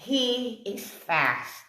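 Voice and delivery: very slow, spaced-out "he is fast"; slow and deliberate, sinking on "fast"; drawn-out verdict. Real, not acted. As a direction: stretch out "he is fast", letting "fast" fall away. Stresses her verdict on mover.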